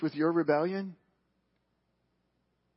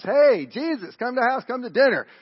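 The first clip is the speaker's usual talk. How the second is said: loud, high, hearty call; calling out invitation; 8 semitones higher, 9 dB louder than usual. Acted. Pharisee inviting Jesus to dinner, calling out. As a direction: call it out like a showy host.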